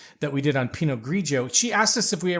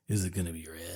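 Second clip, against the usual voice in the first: much lower, softer mock croak; gruff, deep mock of the wife; 11 semitones lower, 11 dB quieter, faster than usual. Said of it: "I didn't say it that way".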